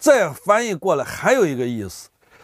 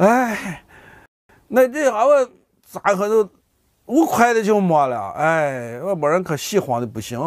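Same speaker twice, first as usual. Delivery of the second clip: high, wailing disbelief, dropping to a sigh; acted shocked lament; 6 semitones higher than usual. Relative just told of a death, high-pitched mock lament. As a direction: play at mourning sudden bad news in dialect.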